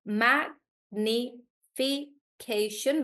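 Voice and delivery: "magnification" stretched out slowly, syllable by syllable; slow syllable read. Shown as a demonstration. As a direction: drag out "magnification" so each syllable is clear. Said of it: "We already did the one".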